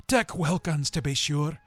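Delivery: remembering a friend; fond recognition. A narrator's reading. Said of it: "said Scrooge to the ghost".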